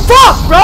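sudden startled gasp then a shouted curse; real startled gasp; sharp gasp. Real, not acted. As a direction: gasp as something jumps out.

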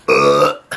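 low loud disgusted ugh; disgusted groan. Acted. Disgusted at table manners.

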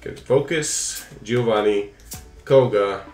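ordinary talk, nothing marked

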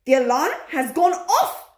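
high, loud witch scream. A narrator's reading. Grand High Witch announcing the alarm to witches, screaming. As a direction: scream it out in triumph. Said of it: "screamed the Grand High Witch".

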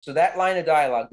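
usual speaking voice

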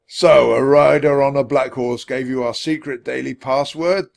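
loud, suspicious officer's question; stern centurion. A narrator's reading. Centurion doubting Jack's story, stern.

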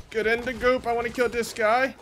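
ordinary talk, nothing marked

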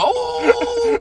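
sudden high-pitched mock cry of shock. Reacting to hot breath in his ear, a high mock yelp. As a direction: yelp it way up high like a startled scream. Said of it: "I thought to go".

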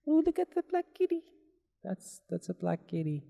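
put-on high, fast baby talk to a kitty; cutesy cooing voice. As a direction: coo at the cat. Cooing at a cat in game.

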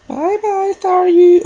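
very high, squeaky sing-song goodbye; cartoonish falsetto waving off the beaten Staryu; squeaky mock farewell. Gamer taunting the fainted Staryu in a squeaky falsetto goodbye. Mocking farewell to defeated Pokemon.

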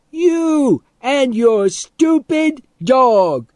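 high, drawn-out loud jeer; gleeful scream. A narrator's reading. Boy insults man and dog, screaming happily. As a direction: scream the insult slowly with relish. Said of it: "Teddy screamed happily".

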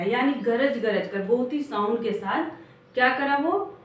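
normal talking voice

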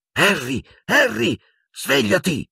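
high, urgent calls to wake him; urgent calling. A narrator's reading. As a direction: call his name sharply to rouse him. Lupin calling to wake Harry.

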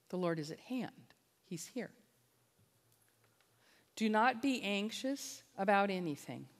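ordinary talk

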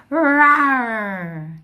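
loud, drawn-out imitated lion roar; big playful roar. Shown as a demonstration. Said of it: "the sound of the lion".

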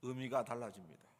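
normal talking voice

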